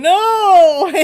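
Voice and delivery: loud laughing mock protest; a high, laughing shout of no. Reader to client, imitating her refusal: loud laughing no. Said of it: "like you going".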